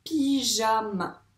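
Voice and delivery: "pyjama" slowly stretched, pii-jaa-ma; slow, connected sounding-out of "pyjama"; drawn-out syllables. Shown as a demonstration. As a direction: draw out each syllable of "pyjama" without stopping. Teacher stretches word for pupil.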